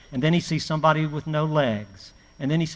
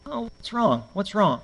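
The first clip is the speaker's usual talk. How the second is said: concerned parent; 5 semitones higher than usual. Retold or imitated. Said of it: "And I say".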